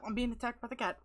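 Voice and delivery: usual speaking voice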